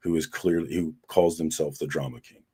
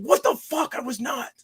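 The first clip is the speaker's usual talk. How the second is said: much higher, squeaky disbelief; mock outrage. To his wife, a high squeaky mock protest. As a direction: squeal it back in mock shock. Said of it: "I'm like".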